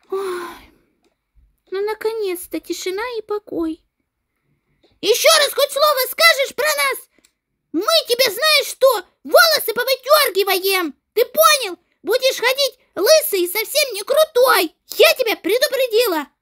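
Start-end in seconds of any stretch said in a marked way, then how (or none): 5.0-16.3 higher, louder schoolgirl threat; a furious girl threatening the classroom bully; angry threat. Acted. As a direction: warn him she'll pull his hair out.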